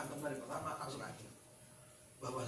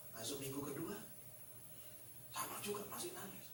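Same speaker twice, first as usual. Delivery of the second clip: ordinary talk, nothing marked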